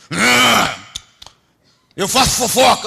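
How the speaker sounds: preacher voicing a demon answering his question. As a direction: give the demon a cocky answer. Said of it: "então você é o fofoqueiro".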